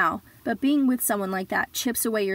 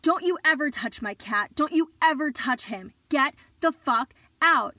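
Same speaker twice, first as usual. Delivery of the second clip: higher, furious shout of protection; furious yell; 5 semitones higher than usual. Retold or imitated. Protecting her cat from the boyfriend, furious yell. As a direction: scream at him to never touch the cat. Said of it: "I was screaming".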